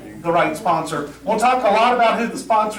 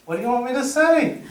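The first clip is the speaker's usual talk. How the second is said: higher, bewildered question; confused Pete; 6 semitones higher than usual. Retold or imitated. A confused castmate asking for help, higher and bewildered. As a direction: play him totally lost.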